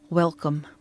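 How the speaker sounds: cool Russian-accented welcome, lightly voiced; an unwelcoming word in a Russian accent; thick Russian accent. A narrator's reading. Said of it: "her accent thick".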